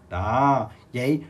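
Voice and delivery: ordinary delivery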